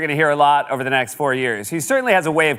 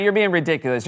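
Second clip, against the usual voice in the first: ordinary talk, nothing marked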